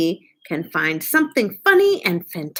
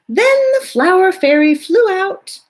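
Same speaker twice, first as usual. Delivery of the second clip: put-on high, bright, louder child; fairy child voice; 10 semitones higher, 5 dB louder than usual. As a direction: chirp it like a little fairy. Student in costume reciting.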